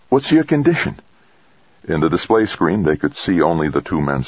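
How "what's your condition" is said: high-pitched character voice for the doctor; physician on comms. A narrator's reading. Base physician takes the astronaut's call, high-pitched professional voice. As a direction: answer the radio in a high voice.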